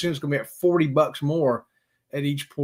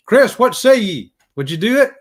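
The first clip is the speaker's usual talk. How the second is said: calling out; 6 semitones higher, 8 dB louder than usual. Real, not acted. Host calling out to viewer Chris, hearty and loud. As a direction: call across the room to Chris.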